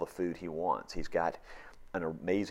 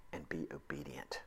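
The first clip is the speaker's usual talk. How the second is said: whisper; 4 semitones lower, 10 dB quieter than usual. Real, not acted. Preacher drops voice on command.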